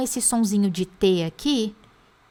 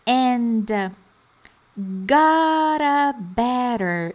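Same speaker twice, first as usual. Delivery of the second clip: slow chunk; 2 semitones higher, much slower than usual. Shown as a demonstration.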